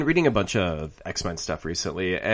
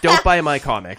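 higher-pitched, punchy retold telling-off; mock brash dismissal; 7 semitones higher, 4 dB louder than usual. Stan Lee telling off bigoted readers, mock brash and high-pitched. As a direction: imitate a feisty writer sending readers packing. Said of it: "he's just like".

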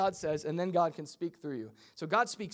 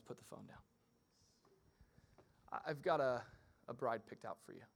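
soft, half-whispered voice of God; a hushed, casual God; 6 semitones lower, 10 dB quieter than usual. Pretend. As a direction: murmur it like a secret aside. God voiced in a comic retelling.

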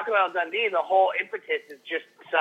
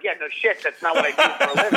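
laughing sarcastic retort; 4 semitones higher than usual. Retold or imitated. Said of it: "I'm like".

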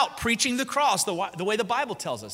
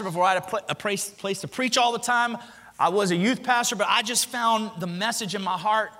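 usual speaking voice

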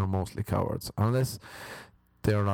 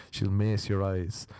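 ordinary talk, nothing marked

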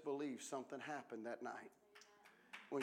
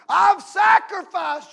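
slow, much higher and much louder shout; mock self-pitying shout; 26 dB louder, slower than usual. Preacher mocking a minister's self-pity, shouted. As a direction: bellow it like a martyr.

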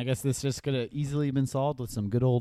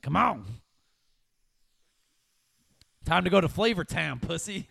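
crackhead lady voice; 6 semitones higher, 5 dB louder than usual. Retold or imitated.

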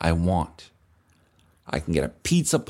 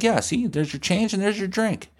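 put-on pushy cool kid; 10 semitones higher than usual. Pushy kid handing change.

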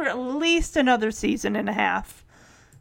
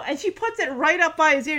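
normal talking voice

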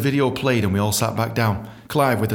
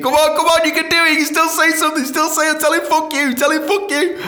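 high, loud, urgent self-urging; frantic inner pep talk; 4 dB louder than usual. Retold or imitated. [name] urging himself to swear at the boss, high and frantic. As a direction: psych yourself up in panic.

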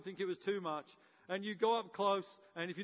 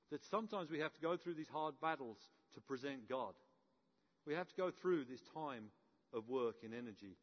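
ordinary talk, nothing marked

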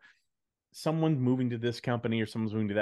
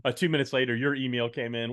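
ordinary delivery

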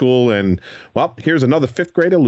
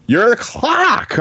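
amused surprise; 12 semitones higher, much slower than usual. Real, not acted. Host amused at boy's part.

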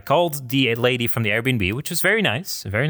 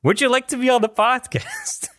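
higher, sing-song host-like offer; mock invitation. Joking about inviting the angry cleaners on the podcast, higher playful voice. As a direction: pitch it like a cheesy podcast host.

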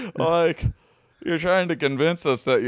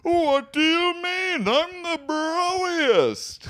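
high, slow booming wizard; game show announcer; 12 semitones higher, slower than usual. Acted. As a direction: boom it like a prize announcer. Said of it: "You sound like a game show announcer".

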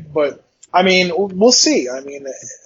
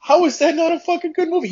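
high, pitched-up disbelief; incredulous outburst; 12 semitones higher than usual. Retold or imitated. Retelling to a friend how he argues the movie's case, high and incredulous. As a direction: sell it like it's obvious. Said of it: "I'm like".

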